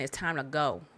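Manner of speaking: ordinary talk, nothing marked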